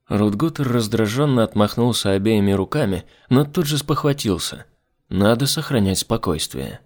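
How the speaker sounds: ordinary delivery, nothing marked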